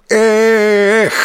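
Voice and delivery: высокий громкий выдох усилия; рывком выкрикнуть «Эх!»; возглас «Эх!». A narrator's reading. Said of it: "с возгласом". (громкий возглас при броске)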